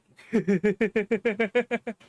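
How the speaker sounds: rapid staccato giggle; a long run of quick, even little laughs. Real, not acted. Player amused by the avatar's half-open eyes, giggling.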